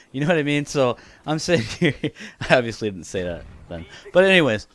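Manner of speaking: ordinary talk, nothing marked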